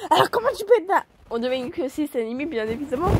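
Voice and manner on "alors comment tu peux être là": high, loud shouted challenge; yelling Sanemi. Acted. Sanemi yells at Anna, suspicious, a loud shout. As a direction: bark it out at the newcomer. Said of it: "Il y a que lui pour gueuler comme ça".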